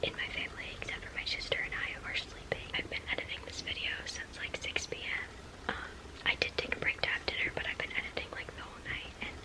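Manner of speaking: soft late-night whisper. Real, not acted. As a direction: whisper so the family sleeps. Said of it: "I have to whisper because".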